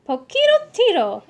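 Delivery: high, loud, delighted cry; joyful exclamation. Acted. Scapito spotting his friend Poquito Tito, high and overjoyed. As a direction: greet your old friend with joy. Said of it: "exclaimed Scapito".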